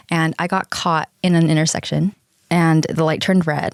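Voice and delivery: ordinary talk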